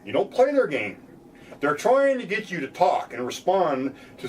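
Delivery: ordinary talk, nothing marked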